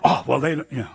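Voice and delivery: ordinary talk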